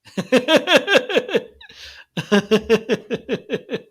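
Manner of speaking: long, high, loud real laughter; real high laughter that runs on. Real, not acted. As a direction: crack up at the chat. Laughing about Amazon erasing messages.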